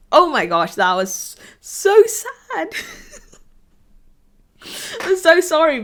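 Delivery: high, loud teary laugh over the words; laughing through tears. Real, not acted. To viewers, recalling the saddest episode, laughing tearfully. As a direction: laugh it off while still choked up.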